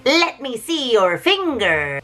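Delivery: slow, drawn-out witch demand. Retold or imitated. Witch inspecting caged Hansel, slow witchy demand. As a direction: stretch each word like a prying witch. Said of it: "she's like".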